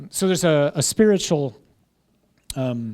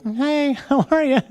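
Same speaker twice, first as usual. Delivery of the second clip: high, bright mock-friendly hello with a laugh; sweetly cheerful greeting before the cut; cheery God greeting; 11 semitones higher, 4 dB louder than usual. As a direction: play God breezing in all smiles. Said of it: "He's like".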